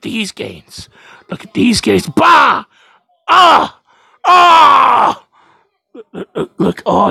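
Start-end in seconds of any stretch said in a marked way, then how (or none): 2.1-5.2 loud high shouts; loud muscle-flex yells; flexing roar. Acted. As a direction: roar like lifting weights.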